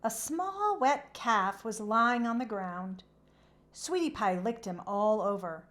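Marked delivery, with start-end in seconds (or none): none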